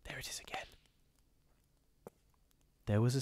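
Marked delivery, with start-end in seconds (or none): 0.0-0.7 breathy, very soft whisper; fearful whisper. Acted. Beaumont warning the narrator of the hoofbeats, whispered. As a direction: whisper so the thing won't hear. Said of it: "he muttered".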